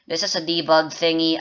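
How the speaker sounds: usual speaking voice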